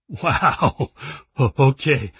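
laughing through the words; failing to hold in a laugh; stifled laughter. Acted. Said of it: "trying hard not to laugh, but was failing badly".

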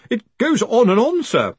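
high, anxious underling's plea; helpless, flustered complaint to a superior; flustered protest. A narrator's reading. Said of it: "Collarbone protested".

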